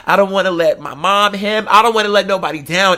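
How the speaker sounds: normal talking voice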